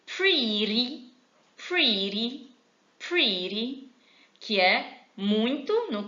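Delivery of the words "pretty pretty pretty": pretty with a flapped t, "priri"; model pronunciation. Shown as a demonstration. Teacher modelling pretty with a flapped t, like "priri". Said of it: "A outra".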